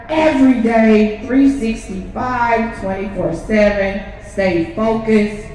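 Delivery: fervent rally speech, slow and loud; preaching. Real, not acted. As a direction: preach it to the crowd.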